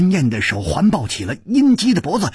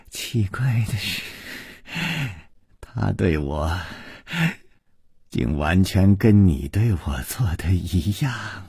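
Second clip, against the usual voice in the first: soft, low, dreamy voice; hushed, lingering reverie after a faint laugh; tender; 7 semitones lower, 4 dB quieter than usual. Acted. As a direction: confide it gently, with long hesitant pauses. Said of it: "柔声地说".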